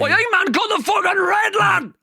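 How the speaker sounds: high, frantic Geordie yelling; Geordie shout. Acted. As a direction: yell urgent orders in broad Geordie. Panicked Geordie bomb-disposal advice, acted.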